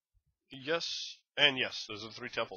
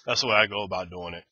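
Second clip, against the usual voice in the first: normal talking voice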